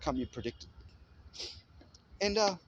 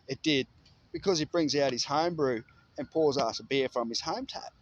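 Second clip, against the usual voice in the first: ordinary talk, nothing marked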